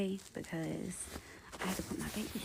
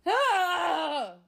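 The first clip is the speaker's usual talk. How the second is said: high, loud frustrated yelp; exasperated scream; 10 semitones higher, 9 dB louder than usual. Real, not acted. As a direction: shriek in disbelief. Ex still texting her.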